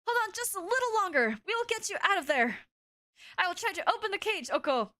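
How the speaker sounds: high cooing bird voice, eager; pigeon character voice. Acted.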